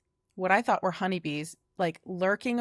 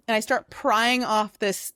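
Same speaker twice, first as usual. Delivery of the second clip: ordinary talk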